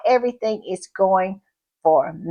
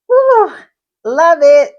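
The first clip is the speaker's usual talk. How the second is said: high, loud whoop of delight; thrilled; 7 semitones higher, 7 dB louder than usual. Real, not acted. Host whooping to viewers over the new podcast, thrilled. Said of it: "I'm thrilled".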